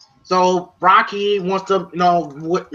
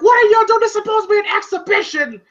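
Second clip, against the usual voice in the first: retold yelling; 11 semitones higher, faster than usual. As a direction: shout it like a fed-up promoter. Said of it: "telling, like".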